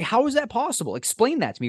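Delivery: mock outrage. Berating a friend for ordering roast beef, in high mock outrage. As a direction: act appalled at his choice.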